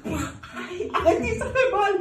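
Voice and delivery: high, loud yelping while being whipped; laughing through fake pain cries; mock cries of pain. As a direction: squeal in hammed-up pain.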